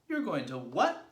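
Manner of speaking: high, loud disbelieving question; incredulous cry. A narrator's reading. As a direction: cry out in astonishment. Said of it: "they cried".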